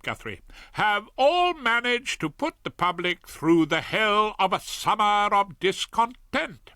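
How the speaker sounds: high, indignant wife reading the paper; high, outraged reading of the news to her husband; Marigold reading aloud. A narrator's reading. Marigold continues reading the editorial.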